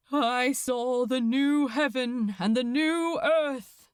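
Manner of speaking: solemn scripture intoned over the crowd; a priest reciting Revelation, steady and grave; intoning priest. Acted. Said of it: "intoned the priest".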